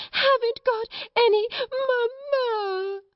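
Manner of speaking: very high, drawn-out wail; howling child. A narrator's reading. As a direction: bawl it like a small girl mid-tantrum. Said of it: "Lottie howled tempestuously".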